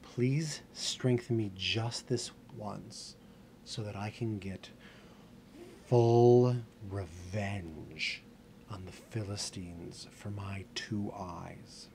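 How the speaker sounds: very slow plea, stretching words, swelling on the vengeful words; solemn, drawn-out entreaty building then sinking; pleading prayer. Acted. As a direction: beg with slow, heavy emphasis. Samson prays for revenge.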